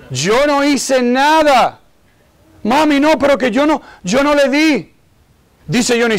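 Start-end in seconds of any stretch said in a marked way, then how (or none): 0.1-1.8 higher, slow, drawn-out mock protest; child's insistent denial. Child denies blame, repeated more insistently. 2.6-4.9 high-pitched kid pleading innocence, imitated; protesting child. Acted child protests innocence to mother.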